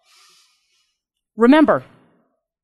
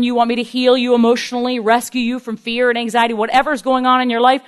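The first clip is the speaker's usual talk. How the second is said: normal talking voice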